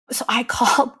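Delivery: ordinary talk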